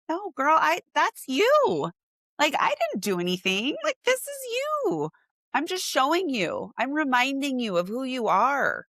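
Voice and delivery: high, bright-pitched insistent reassurance; gushing reassurance. Retold or imitated. Photographer to thrilled client, high-pitched gushing reassurance. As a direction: hype her up, girl to girl. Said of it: "And I'm like".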